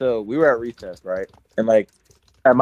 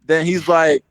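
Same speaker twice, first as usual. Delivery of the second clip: ordinary talk, nothing marked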